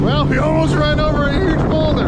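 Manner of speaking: high, loud startled outburst. Real, not acted. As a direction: blurt it out after a near miss. Nearly hits a boulder.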